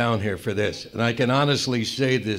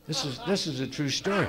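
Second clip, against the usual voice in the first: laughing aside; 6 dB quieter than usual. Real, not acted. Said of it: "I swear to God".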